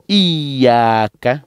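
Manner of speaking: "Iyaka" leaning on the doubled y; "Iyaka" as iyyaa-ka, drawn out; pressed, stretched ya. Shown as a demonstration. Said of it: "il faut appuyer et tirer un peu".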